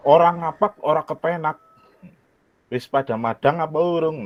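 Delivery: leicht im Kebumen-Dialekt vorgesprochen; Kebumen accent. Shown as a demonstration. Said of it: "with the nice accent, ya, Kebumen accent".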